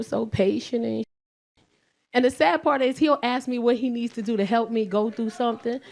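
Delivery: ordinary talk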